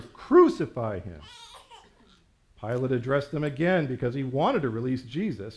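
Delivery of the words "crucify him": slow, loud drawn-out cry; louder shout. A narrator's reading. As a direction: shout it slowly as an insistent crowd. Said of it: "kept shouting even louder".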